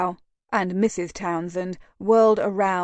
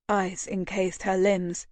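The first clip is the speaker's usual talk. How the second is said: usual speaking voice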